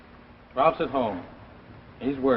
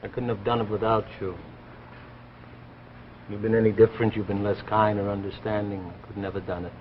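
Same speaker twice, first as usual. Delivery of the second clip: tender gratitude; 7 semitones lower, 5 dB quieter than usual. Acted. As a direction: lower and softer, sincere thanks. Husband thanks wife softly.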